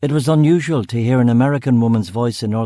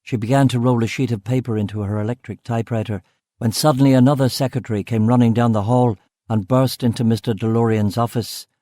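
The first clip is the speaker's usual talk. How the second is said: ordinary delivery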